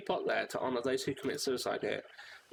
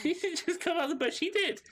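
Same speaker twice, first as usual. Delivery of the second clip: high excited squeal. Real, not acted. Gamer realizes the stranger came to die, high excited squeal. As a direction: squeal as the penny drops.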